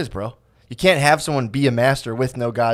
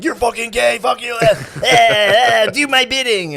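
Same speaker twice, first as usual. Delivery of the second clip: much higher, shouted sneering troll; mocking mob voice; 10 semitones higher, 4 dB louder, slower than usual. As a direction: ham up a screaming troll.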